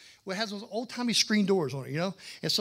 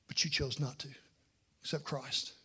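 whispered, hushed; a sermon's hushed sting; 4 semitones lower, 5 dB quieter than usual. Real, not acted. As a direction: lean in and whisper it. Preacher drops to whisper.

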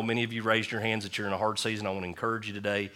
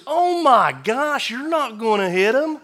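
put-on very high, loud, slow scornful old-man voice; mocking old man; 11 dB louder, slower than usual. Old man scoffs at the golfer, high loud mockery. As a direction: sneer it like a cranky old member.

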